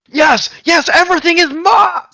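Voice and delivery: high, loud gloating cry; triumphant shout. A narrator's reading. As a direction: shout it like a madman winning. Errol gloats, arms raised.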